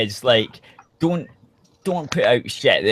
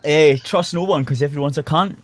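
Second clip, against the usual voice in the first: put-on a quick, lightly done Scottish accent on request. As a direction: toss it off in a mock Scottish accent. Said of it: "You gotta say it in a Scottish accent".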